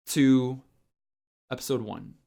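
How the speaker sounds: ordinary talk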